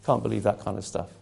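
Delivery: imitating a scornful sneer, starting whispered; dismissive mock scoff, soft and low. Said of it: "They just join in the big sneer".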